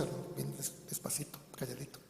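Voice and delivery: drops to a hushed, gentle murmur; soft and quiet. Shown as a demonstration. Preacher showing the congregation how gently he speaks to his wife, hushed. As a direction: show how softly he talks to his wife. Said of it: "Yo así le hablo a mi esposa".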